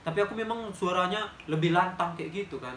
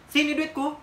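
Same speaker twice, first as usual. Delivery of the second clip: angry demand; 9 semitones higher, 5 dB louder than usual. Retold or imitated. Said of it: "aku gituin kan".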